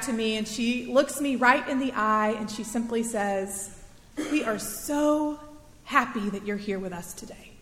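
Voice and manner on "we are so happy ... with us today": tender welcome. Retold or imitated. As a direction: say it gently, like a blessing. Stranger's kind words retold.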